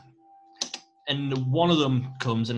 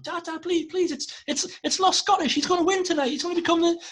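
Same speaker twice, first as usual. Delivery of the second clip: put-on high, pleading kid's voice, much higher; excited child; 3 dB louder than usual. Kids tug dad's coat, begging high and excited. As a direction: beg like an excited little kid.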